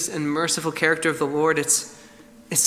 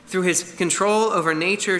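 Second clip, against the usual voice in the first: normal talking voice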